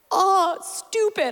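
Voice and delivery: slow, high choked-up aside; fighting tears. Real, not acted. Pastor choking up while thanking her friend, slow. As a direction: catch yourself tearing up. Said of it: "I was gonna try not to cry".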